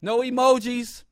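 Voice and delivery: ordinary talk, nothing marked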